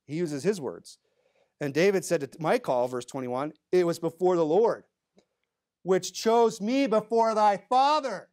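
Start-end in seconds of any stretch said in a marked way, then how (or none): 3.7-8.3 loud, high, emphatic retort; acted indignant comeback, drawn out; firing back. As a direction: fire it back at full volume. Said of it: "he just fires right back".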